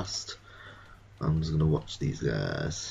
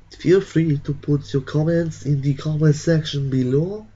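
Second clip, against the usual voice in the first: higher, brighter sign-off pitch; upbeat outro; 8 semitones higher, 5 dB louder than usual. Real, not acted. Vlogger asking viewers for comments, upbeat and loud. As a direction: sell the outro.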